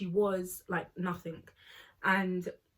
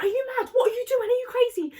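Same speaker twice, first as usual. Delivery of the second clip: much higher, louder yelling; outraged shout; 14 semitones higher, 6 dB louder, faster than usual. Retold or imitated. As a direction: scream at the door-slammer. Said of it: "Bestie was like".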